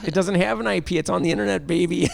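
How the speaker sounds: high, smug developer voice; mock a clueless developer. Mocking a developer.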